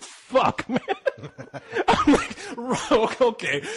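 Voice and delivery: laughing through the words; can't stop laughing at himself. Real, not acted. Laughing at embarrassment.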